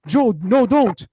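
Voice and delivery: mock-urgent attempt to stop someone; acted urgent warning. Retelling Irving stopping Joe's throw, urgent warning.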